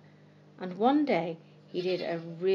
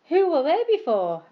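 put-on children asking together; 8 semitones higher, 6 dB louder than usual. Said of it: "asked Jenny and Jem together".

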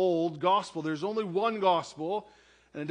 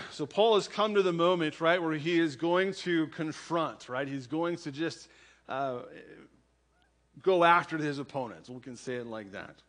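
ordinary talk

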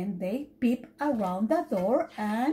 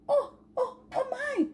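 high, breathless gasps of alarm; mother duck gasping at a missing duckling; 13 semitones higher than usual. Acted. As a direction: gasp in sudden worry. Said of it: "she gasped".

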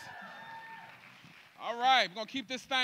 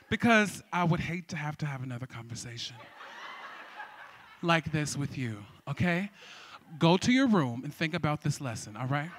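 low, quiet scolding put on for laughs; mock stern parent; 8 semitones lower, 4 dB quieter than usual. Host sending crowd to their room, mock-stern and low. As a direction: lecture them like naughty children.

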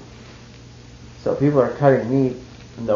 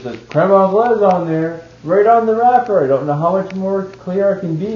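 louder, higher imitation of a fed-up chef; hammed-up rant about the wrapper; mock exasperated chef; 6 semitones higher, 6 dB louder than usual.

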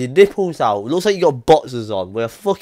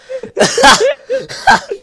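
high-pitched laughing fit; 4 dB louder than usual. Real, not acted. Laughing at the photo.

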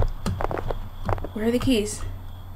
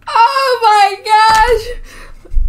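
high, very slow, drawn-out exclamation; giggly exclamation; 9 semitones higher, 3 dB louder, much slower than usual. Real, not acted. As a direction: stretch it out, worn out from laughing. Reeling after scare.

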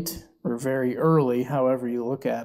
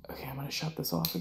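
whispering; 8 dB quieter, much faster than usual. Real, not acted.

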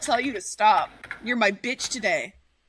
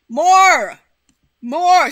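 loud, greedy yell for more; demanding shout; 5 semitones higher, 14 dB louder than usual. Real, not acted. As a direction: bellow it like a hungry boss.

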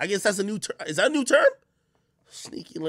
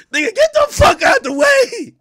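high, loud frustrated yell; exasperated shout; 6 dB louder than usual. Retold or imitated. Shouting at the man blocking the view, high and loud. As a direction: scream at him to move aside. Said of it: "Like, yo".

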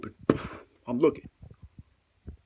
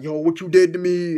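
loud, raised mock outrage; indignant confrontation; 4 semitones higher, 7 dB louder than usual. Bat pops out of bucket to confront him, mock indignant shout.

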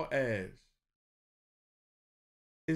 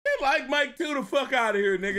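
loud disbelief; 9 semitones higher, 7 dB louder than usual. Real, not acted.

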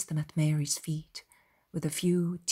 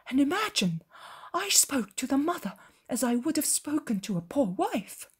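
animated shepherd, pitch lifting on key words; earnest shepherd; 5 semitones higher, 4 dB louder than usual. Acted. Shepherd urging companions to visit the poor mother, earnest. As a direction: urge them along with lively, emphatic feeling.

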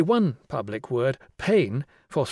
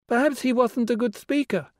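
put-on high child guessing; a kid's guess; 11 semitones higher than usual.

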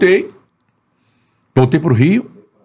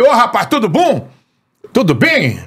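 high-pitched mimicked host's greeting; Antônio Carlos; 9 semitones higher than usual. Radio host greeting a fan who called him, high-pitched impression. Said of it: "Antônio Carlos".

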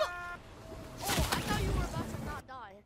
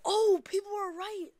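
sudden high gasp of surprise; surprised gasp; 6 semitones higher, 8 dB louder than usual. Real, not acted. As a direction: caught off guard, gasping. Gasps at revealed skin.

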